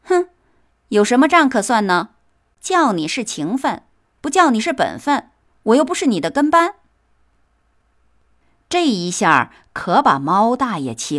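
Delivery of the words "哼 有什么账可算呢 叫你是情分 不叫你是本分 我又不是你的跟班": fast, high, careless brush-off; dismissive. Acted. Mouse shrugs off the angry cat, fast and dismissive. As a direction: toss it off as if nothing matters. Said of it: "满不在乎地说".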